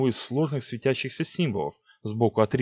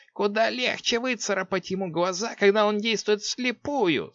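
hissing sorceress; 11 semitones higher than usual. A narrator's reading. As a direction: hiss it like a sly witch. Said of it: "сказала она шипящим голосом".

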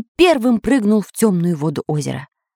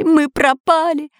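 higher, slow panicky cry; panicked wail; 7 semitones higher, slower than usual. A narrator's reading. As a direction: wail it like all is lost. Panicking robbers in dark cave.

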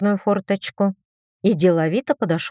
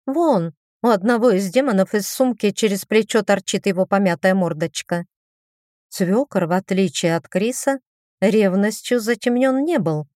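ordinary delivery

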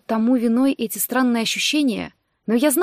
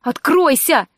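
loud, higher-pitched command; urgent raised-voice demand; frantic repeating; 5 semitones higher, 5 dB louder, slower than usual. A narrator's reading. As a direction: order it to obey, worked up. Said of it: "повторяла я, как заведённая".

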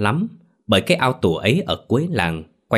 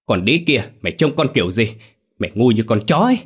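loud, furious yelling, fast at the end; a father screaming blame at his wife; shouted abuse, rising in rage; angry shout at wife; 2 semitones higher, 4 dB louder, faster than usual. Acted. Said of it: "hét lên".

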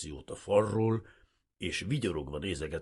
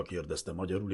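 ordinary delivery

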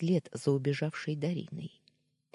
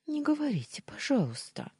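pleading; 11 semitones higher than usual. A narrator's reading. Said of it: "взмолилась".

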